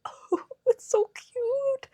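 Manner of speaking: very high, drawn-out squeal; tiny squeaky voice of someone melting; cute-overload squeal. Retold or imitated. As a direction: coo it way up high, slowly. Retelling reaction to cute pattern.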